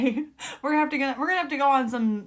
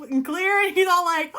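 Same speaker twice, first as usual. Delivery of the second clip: ordinary talk, nothing marked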